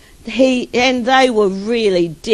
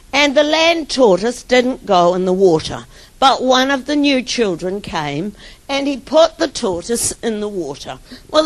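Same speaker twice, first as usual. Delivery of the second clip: ordinary talk, nothing marked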